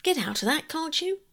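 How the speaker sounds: rising rough snap; disagreeable. Acted. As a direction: shoo them off, annoyed. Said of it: "in most disagreeable tones".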